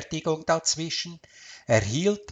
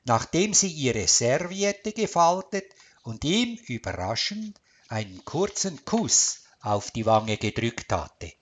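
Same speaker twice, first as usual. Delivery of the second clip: usual speaking voice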